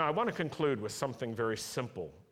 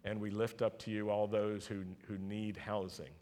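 prayer; 3 semitones lower, 4 dB quieter than usual. Acted. Pastor praying softly over the congregation. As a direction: pray gently over the people. Prays for a family.

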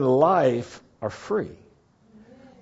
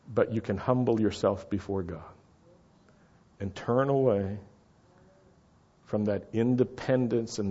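soft, lowered, unhurried preaching; hushed, earnest altar call; solemn appeal; 7 dB quieter than usual. Real, not acted. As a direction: press the point gently, with long pauses.

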